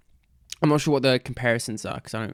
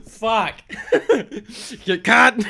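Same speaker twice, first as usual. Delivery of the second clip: laughing; 11 semitones higher, 3 dB louder, much slower than usual. Real, not acted. Laughing at joke.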